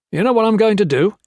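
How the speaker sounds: high, loud and fast, pressing the threat; menacing threat. Acted. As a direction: rattle off the threat, cornering him. Gray threatens Blakely with exposure.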